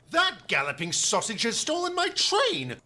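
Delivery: a touch of outrage over the stolen train; mild exclaim. Acted. Edward exclaiming at Gordon, mild indignant outburst. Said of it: "he exclaimed".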